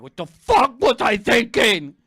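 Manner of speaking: loud, slow yell of regret; exasperated self-reproach. Acted. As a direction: yell it at yourself, word by word. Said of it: "you're thinking like".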